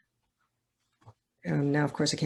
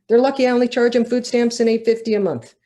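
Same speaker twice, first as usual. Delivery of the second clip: louder, higher, fed-up retort; a defiant huff, higher and louder; indignant outburst; 7 semitones higher, 8 dB louder than usual. Acted. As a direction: throw it back at the world, indignant. Character's bitter inner outburst.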